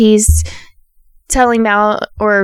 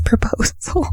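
whispered; 6 dB quieter than usual. Real, not acted. Recapping the rejection to viewers, in a whisper. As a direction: drop to a breathy whisper on the word. Drama word dropped mid recap.

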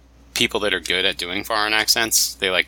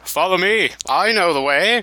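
high, hammed-up Middle Eastern film guide; Sallah; 9 semitones higher, 3 dB louder than usual. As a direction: beckon in a put-on movie accent. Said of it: "from Indiana Jones and the Last Crusade".

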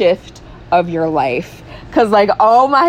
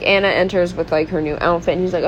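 usual speaking voice

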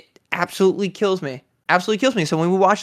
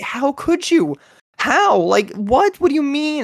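retold shock; 10 semitones higher, slower than usual.

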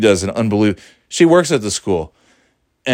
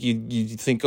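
usual speaking voice

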